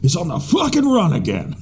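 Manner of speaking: high hammed-up Pacino rant; Al Pacino as Alfred. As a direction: ham it up like Pacino. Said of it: "Al Pacino is Alfred".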